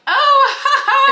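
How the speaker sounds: high, loud "oh, ha, ha"; mock laugh. Host acts out both hosts reacting to the caller, mock laughing.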